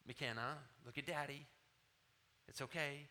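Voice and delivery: soft, low, gentle reassurance; calm a small child gently; compassionate daddy. Retold or imitated. Father soothing his two-year-old before stitches, compassionately. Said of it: "No matter how compassionate I can be".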